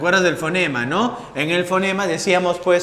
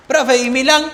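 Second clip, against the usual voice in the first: student voice; 7 semitones higher, 6 dB louder than usual. Acted. Teacher voices a student asking.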